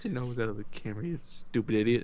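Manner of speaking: fast, soft mock scold; quiet mock scolding. Real, not acted. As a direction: hiss a fond insult at the cat. Scolding cat, much softer.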